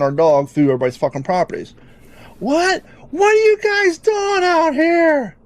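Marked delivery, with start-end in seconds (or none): 2.4-5.3 put-on high, slow indignant old lady voice; annoyed old lady. Old lady demanding why they're in her yard, high and drawn out. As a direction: stretch the words out, demanding and suspicious.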